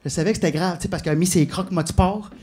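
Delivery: ordinary talk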